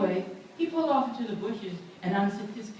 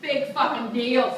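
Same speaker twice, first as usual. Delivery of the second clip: defiant; 5 semitones higher, 6 dB louder, slower than usual. Retold or imitated. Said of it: "said Susan".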